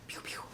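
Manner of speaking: soft whispered blaster noises; whispered pew pew. Pretend. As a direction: whisper the laser shots under your breath. Imitating arm cannon firing.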